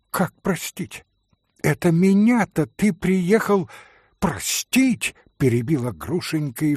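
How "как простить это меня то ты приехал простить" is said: indignant outrage. Acted. Грушенька вскакивает в возмущении.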